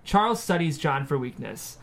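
normal talking voice

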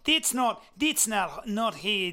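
failed Aussie accent that lands on Russian; badly faked Australian; botched Australian accent. Shown as a demonstration. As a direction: attempt Australian, come out vaguely Russian. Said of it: "let me show you how you do in Australia".

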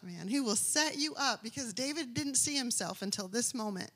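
ordinary talk, nothing marked

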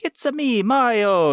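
high, loud cartoon catchphrase; Mario. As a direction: sing out the catchphrase for the cameras. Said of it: "and then going, like".

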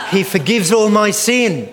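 loud, high cry of praise; proclaiming. Acted. Preacher leading the congregation in psalm call and response. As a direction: call it out to cue the room. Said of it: "I'm going to give you the signal".

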